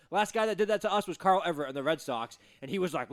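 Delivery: normal talking voice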